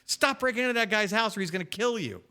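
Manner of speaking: ordinary delivery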